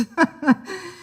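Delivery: short high snicker; a quick mocking chuckle. A narrator's reading. Man amused by the diary, snickering. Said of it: "He snickered again".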